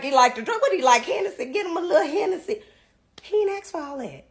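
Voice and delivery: normal talking voice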